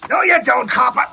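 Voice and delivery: sudden loud, high yell of surprise; startled shout. Acted. Gangster yelling at the cop who spots him. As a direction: bark it out as the cop jumps you.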